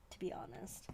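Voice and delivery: a soft, voiceless whisper of to be honest; whispered aside. Real, not acted. A joking whisper to viewers about her distressing opinion. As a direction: drop it to a confiding whisper.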